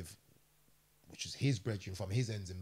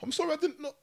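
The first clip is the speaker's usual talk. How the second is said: high, lightly louder pleading voice; remorseful attacker; 7 dB louder than usual. Retold or imitated. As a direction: sound genuinely lost about what you did. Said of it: "he started going".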